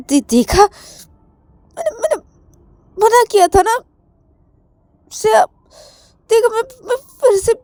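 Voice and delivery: upset. Acted.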